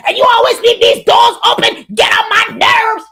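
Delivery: high, loud mock yell at a housemate. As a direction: yell it, fed up with the open doors. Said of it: "then you're yelling".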